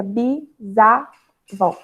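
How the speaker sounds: "bisavó" split as bi, sa, vó; syllable division. Shown as a demonstration. Teacher shows the separation, syllable by syllable. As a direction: pause after each syllable of "bisavó". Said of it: "a separação fica".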